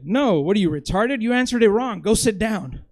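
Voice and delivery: harsh teacher's scolding. Retold or imitated. Professor humiliating a student at the board, scornful rebuke. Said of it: "he just responded".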